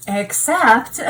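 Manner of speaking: laughing out loud. Real, not acted. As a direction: bursts out laughing. Laughing at the prosecution's case.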